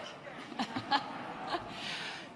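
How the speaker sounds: breathy, soft chuckle; laugh. Real, not acted. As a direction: laugh lightly at the crowd's reaction. Amused by crowd's reaction.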